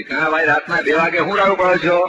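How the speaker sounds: put-on angry old uncle yelling across the lane; annoyed neighbour. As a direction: snap at the noisy neighbour.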